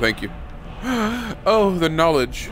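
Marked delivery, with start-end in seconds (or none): 0.3-1.4 sharp excited gasp. Real, not acted. Surprised by new power. 1.5-2.5 slow, high, louder awe; awed. Acted. Absorbing the word of power.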